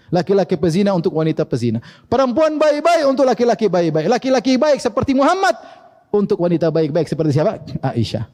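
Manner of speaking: ordinary talk, nothing marked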